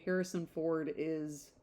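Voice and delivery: normal talking voice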